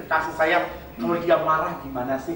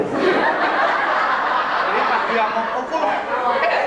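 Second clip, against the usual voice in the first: laughing; 5 semitones higher, 4 dB louder, much slower than usual. Real, not acted. Joking about taekwondo, laughing.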